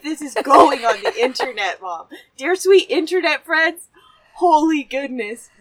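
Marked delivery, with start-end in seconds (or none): none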